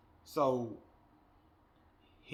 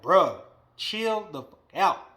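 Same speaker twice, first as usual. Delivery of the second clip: exasperated slow scold; 4 semitones higher, 10 dB louder, slower than usual. Real, not acted. Scolding a spoiled wrestler.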